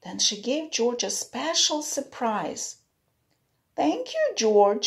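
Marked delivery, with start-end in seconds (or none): 3.7-4.9 high, warm thank-you; grateful owner. A narrator's reading. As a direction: thank him brightly. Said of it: "she said".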